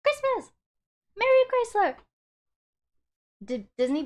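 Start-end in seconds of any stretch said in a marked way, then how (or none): 0.0-1.9 high, loud silly cheer; excited. Acted. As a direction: shout it with goofy festive joy. Silly festive shouts.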